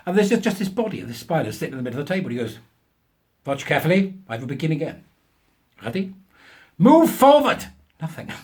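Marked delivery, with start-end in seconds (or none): 3.5-7.7 mock German professor. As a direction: ham up a self-important German scientist. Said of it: "of German extraction".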